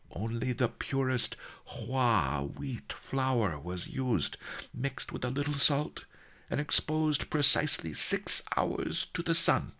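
high, measured recipe recital; precise, high-voiced old master; dignified old master. A narrator's reading. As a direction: recite each step with prickly pride.

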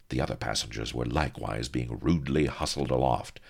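ordinary delivery

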